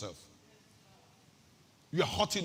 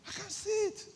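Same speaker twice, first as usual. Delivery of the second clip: pitched way up, mock complaint; high exasperated repeat. Shown as a demonstration. Preacher teasing the congregation, high mock complaint. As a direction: squeak it out in disbelief. Repeats the line, much higher.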